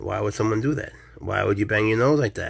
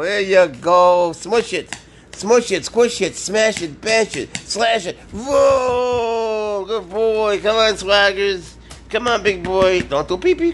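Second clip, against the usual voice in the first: excited pet talk; 9 semitones higher, 7 dB louder, slower than usual. Real, not acted. Owner luring the cat to a toy snake, high excited baby talk. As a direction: get the cat hyped up. Cheering cat's play.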